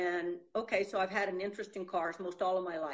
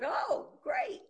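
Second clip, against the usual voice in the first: sarcastic; 14 semitones higher than usual. Retold or imitated. Sarcastic retold reply.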